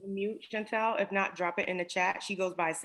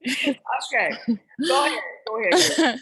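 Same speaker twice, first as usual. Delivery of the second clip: loud; 7 semitones higher, 9 dB louder than usual. Real, not acted. Cheerfully urging a laughing guest on.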